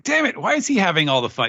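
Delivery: high, fast mock-angry whine; comic jealous outburst, rushed; Luffy. Host as mad Luffy watching rivals, high rushed grumble. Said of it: "Luffy's mad that they're".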